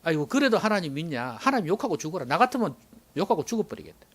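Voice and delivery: wife's scolding in standard Korean; Job's nagging wife. Acted. Job's wife urges him to curse God, mild scolding. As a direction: nag sharply in standard Korean, without the dialect. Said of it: "마누라가 와가 또 뭐라 하는지 아십니까?".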